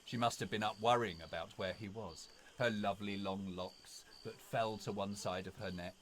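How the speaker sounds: ordinary delivery, nothing marked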